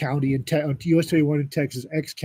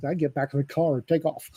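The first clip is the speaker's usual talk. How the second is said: ordinary delivery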